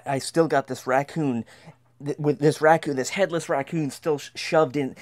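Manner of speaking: ordinary delivery, nothing marked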